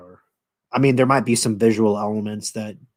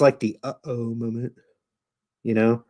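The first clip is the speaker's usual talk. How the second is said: ordinary talk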